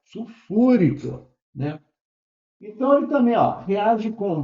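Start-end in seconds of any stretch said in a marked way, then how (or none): 0.0-1.3 Sulfúrico said slowly, stress on fú; stress demo. Shown as a demonstration. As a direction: stretch Sulfúrico, leaning on the accented fú. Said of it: "paroxítona, aí ele é acentuada".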